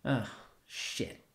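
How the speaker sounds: breathless frightened whisper; whispered dread; scared whisper. Acted. Realizes danger alone.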